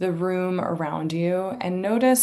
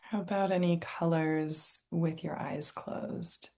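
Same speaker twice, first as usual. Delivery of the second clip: calm, unhurried meditation guide, long pauses; guided meditation; 5 dB quieter than usual. Real, not acted. Inviting listeners to tune into senses and breath, guided meditation. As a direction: softly guide listeners into relaxation.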